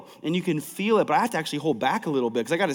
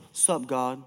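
lower, much softer, careless mock greeting; mock bored greeting; 6 semitones lower, 9 dB quieter than usual. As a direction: toss it off like you barely care. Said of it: "the person who's like".

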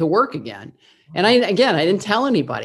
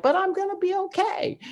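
much higher, lightly squeaky mock of her teen self; sing-song reassurance. Teen daughter insisting to her weeping mom, very high mock voice. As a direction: chirp it up high, cheerfully insisting.

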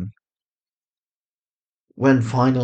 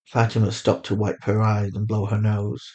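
plain reading voice

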